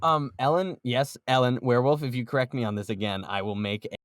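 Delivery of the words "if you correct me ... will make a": ordinary talk, nothing marked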